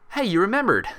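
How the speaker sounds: high, bright delighted exclaim; happy declaration. Acted. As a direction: cheer it like a proud friend. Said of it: "Applejack declared, happily".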